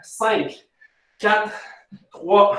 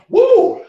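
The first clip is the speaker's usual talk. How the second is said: cri d'effort aigu; 6 dB louder than usual. Real, not acted. Coach whoops during ab workout.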